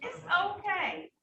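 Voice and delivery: calm a frustrated man down; calm reassurance. Real, not acted. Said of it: "do you hear me angry or upset?".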